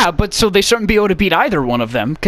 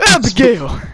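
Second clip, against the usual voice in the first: high strained mock-pained shouting; hammed-up anguished cowboy, pitched way up; John Marston impression; 5 semitones higher than usual. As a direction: call out as if constantly in pain. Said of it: "It sounds like he's in pain constantly".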